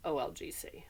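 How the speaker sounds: unvoiced whisper; a soft whisper aside. Shown as a demonstration. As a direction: breathe the words. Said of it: "whispers option".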